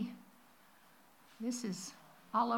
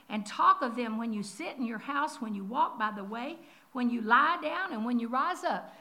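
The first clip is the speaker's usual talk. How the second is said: louder, solemn reading of scripture; scripture recitation; 5 dB louder than usual. Acted. Preacher reading Deuteronomy aloud to the congregation, solemn and loud.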